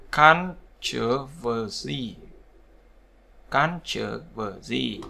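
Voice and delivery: Controversy very slow, syllable by syllable; slow CON-tro-ver-sy demo; stress on first syllable. Shown as a demonstration. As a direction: draw out each part of Controversy, first one stressed. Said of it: "vào âm tiết thứ nhất".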